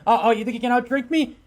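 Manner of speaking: high, rapid taunting comeback; cocky challenge. Acted. Wukong answering Thor's toast, cocky challenge. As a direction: fire back at a drinking dare.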